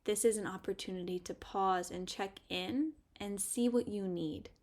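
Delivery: ordinary talk, nothing marked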